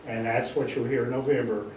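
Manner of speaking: normal talking voice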